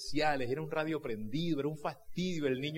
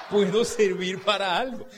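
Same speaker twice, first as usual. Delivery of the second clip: más alto y más fuerte, riendo; emoción entre risas; 7 semitones higher, 8 dB louder than usual. Retold or imitated. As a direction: gritarlo feliz, como un descubrimiento. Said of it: "decía yo". (euforia riendo de saberse útil)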